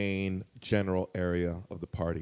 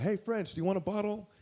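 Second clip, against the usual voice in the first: high, light voice of a favor-seeker; lightly acted high-pitched offer; imitated friendly offer; 10 semitones higher, 4 dB quieter than usual. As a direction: pitch it up as if approaching a celebrity. Imitating the man approaching a celebrity.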